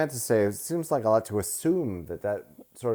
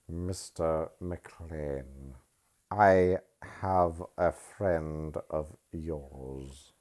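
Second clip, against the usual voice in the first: villain impression; 4 semitones lower, 5 dB quieter, much slower than usual. Impersonating villain from the movie.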